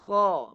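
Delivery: Kha begun with a rattling snore at the throat; a strong rasp then release on Kha; throaty kha. Shown as a demonstration. Teacher shows the snore-like start of kha. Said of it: "bunyi dengkuran tu di awal".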